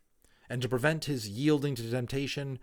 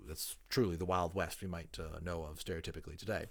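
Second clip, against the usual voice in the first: quieter, chatty explaining aside; aside to listeners; 5 semitones lower, 4 dB quieter than usual. Real, not acted. Reader pausing the book to explain history to listeners, low-key aside. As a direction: step out of the story and explain casually.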